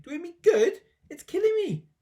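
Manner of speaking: high, loud wailing shriek; screaming. A narrator's reading. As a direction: shriek as if in agony. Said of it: "she screamed".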